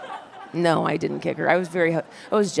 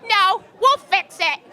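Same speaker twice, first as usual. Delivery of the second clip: higher, slow, breezy landlady voice; 13 semitones higher, 4 dB louder, slower than usual. Retold or imitated. Landlady refusing the towel, high breezy put-on voice. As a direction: brush off the offer airily.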